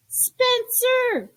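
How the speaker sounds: calling his name up the stairs, high and loud; yelling. Acted. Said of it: "she yelled on her way up the stairs".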